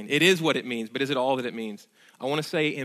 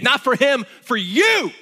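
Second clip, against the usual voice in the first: emphatic shout; 9 semitones higher, 8 dB louder, slower than usual. Real, not acted. Preacher stressing personal payment.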